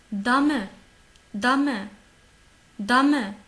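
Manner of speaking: DAME in two syllables, "da-me", loud; emphatic teacher's model "Dame", ending voiced; sounded final E. Shown as a demonstration. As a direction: pronounce the final e of Dame clearly. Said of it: "le E, il n'est pas muet".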